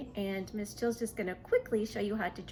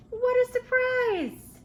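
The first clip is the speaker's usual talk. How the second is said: much higher, louder, slow amazed exclamation; big surprise; 10 dB louder, slower than usual. Acted. Reader reveals the seahorse to the children, amazed. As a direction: let it burst out in wonder.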